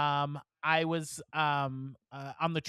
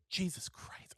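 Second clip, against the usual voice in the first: whispered shock; 2 semitones higher, 9 dB quieter, slower than usual. Real, not acted.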